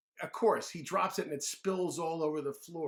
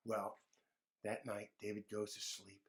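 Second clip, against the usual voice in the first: plain reading voice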